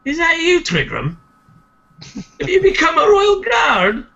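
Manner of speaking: high, loud call of recognition; surprised, high-pitched greeting; excited hail. Acted. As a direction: exclaim it high, delighted at an old friend. Durgan hails a guard he knows.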